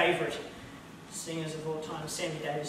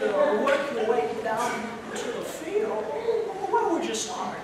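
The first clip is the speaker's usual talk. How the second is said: higher, loud, stammering wh-wh- voice; a much higher, loud, stammering man who is baffled and laughing; Jimmy Stewart in Rear Window; 9 semitones higher, 5 dB louder than usual. Retold or imitated. A neighbour wonders about the killer, high stammering Stewart voice. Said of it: "Hitchcock movie called, uh, Rear Window".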